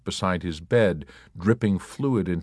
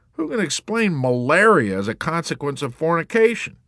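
high, louder indignant question; warrant officer; 9 semitones higher, 4 dB louder than usual. A narrator's reading. Warrant officer protesting his malaria, indignant. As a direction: demand an answer.

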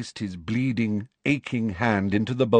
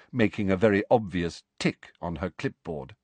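ordinary delivery, nothing marked

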